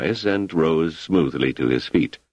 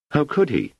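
ordinary delivery, nothing marked

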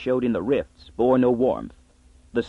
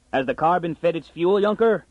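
shouted call; 6 semitones higher than usual. Acted. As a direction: call out through the door. Father shouts from inside.

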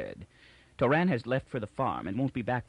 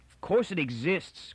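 higher-pitched, indignant outburst; angry cry; 7 semitones higher than usual. A narrator's reading. Miz insisting the second foundation exists, angry cry. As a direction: snap back angrily, pitch raised. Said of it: "cried Miz angrily".

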